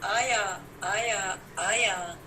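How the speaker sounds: measured verse, each line settling at its end; poem recital. Acted. Poet urges gathering to burn the inner Ravana, declaimed verse.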